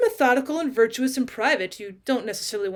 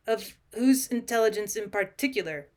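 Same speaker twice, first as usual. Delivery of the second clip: usual speaking voice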